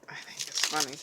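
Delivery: breathy aside whisper. Real, not acted. Reading notes, whispering to herself as an aside. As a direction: whisper it under your breath.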